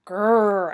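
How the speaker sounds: loud drawn-out "grrr"; scary growl. A narrator's reading. Child clown trying to scare two girls, growling. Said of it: "I made a scary clown face".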